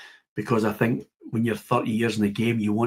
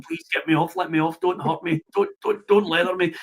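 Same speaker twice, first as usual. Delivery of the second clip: John Baird pleading; 7 semitones higher than usual. Retold or imitated. Player begging the host on stage, high mock pleading. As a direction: mimic a nervous plea, pitched well up. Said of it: "under his lips, he's going".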